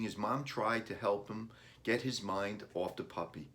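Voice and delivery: ordinary delivery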